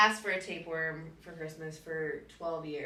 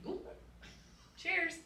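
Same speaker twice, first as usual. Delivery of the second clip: mock Australian cheers; 9 semitones higher, 8 dB quieter than usual. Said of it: "I'll be like".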